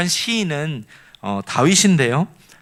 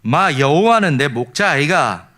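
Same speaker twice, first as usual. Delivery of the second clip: Gyeongsang dialect. Shown as a demonstration. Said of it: "이제 경상도는".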